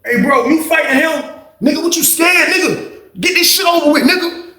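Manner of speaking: high-pitched heated goading, retold; snapping on him. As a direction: imitate someone egging a reluctant fighter on. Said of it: "He snapped on him in the pod".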